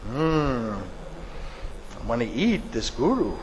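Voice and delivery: low, quiet musing tiger; scheming tiger. Acted. Tiger sizes up the guru as a meal, quietly scheming. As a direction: think it aloud, low and soft.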